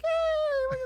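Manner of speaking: very high squealed "yay"; mock cheer. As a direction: squeal with childish glee.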